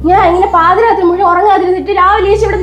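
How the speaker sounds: angry crying outburst; crying complaint. Acted. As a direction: sob out her exhaustion at him. Exhausted wife cries at husband.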